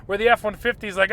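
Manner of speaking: normal talking voice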